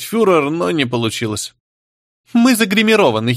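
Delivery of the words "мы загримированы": high, giggly character voice without laughter; playful high-pitched quip. A narrator's reading. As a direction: pitch it well up, as if tittering. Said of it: "хихикнула сопля".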